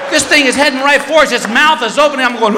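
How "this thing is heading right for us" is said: normal talking voice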